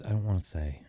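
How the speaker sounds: soft, retold child's refusal; hushed reluctance in a retold quote; reluctant child. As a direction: imitate a kid quietly holding back.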